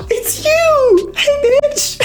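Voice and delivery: very high, hammed-up squeal; mock squeal of recognition. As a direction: squeal as if spotting a long-lost friend. Acting his sudden recognition.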